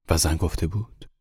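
ordinary delivery

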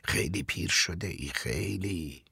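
soft, tender address; hushed, fading on the last word; gently quiet. A narrator's reading. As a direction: speak softly to an old acquaintance. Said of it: "به آرامی کنارش رفتم و گفتم".

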